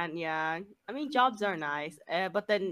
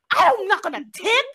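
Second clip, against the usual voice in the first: mock indignation; 13 semitones higher, 7 dB louder than usual. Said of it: "He's like".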